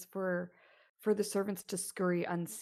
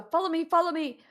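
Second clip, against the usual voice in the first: groom calling, louder and higher; urgent groom; 9 semitones higher, 10 dB louder than usual. Acted. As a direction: hurry the lad along. Groom leads player urgently.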